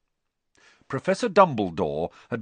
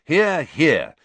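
loud, raised cheer of agreement; heartily second the headmaster; loud approval; 5 semitones higher, 8 dB louder than usual. A narrator's reading. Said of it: "said Harry and Ron loudly".

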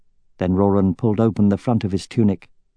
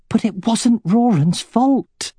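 high, slow, pleading exclamation; 13 semitones higher, slower than usual. A narrator's reading. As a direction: cry out in protest, drawn out. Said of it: "exclaimed Katrina".

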